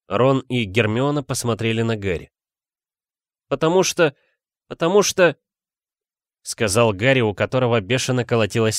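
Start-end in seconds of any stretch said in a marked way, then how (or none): 3.5-5.4 high, hesitant false start; запинающийся школьник. Acted. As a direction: stall for an answer, pitched up. Гарри запинается на допросе. (неуверенно запинаться высоким голосом)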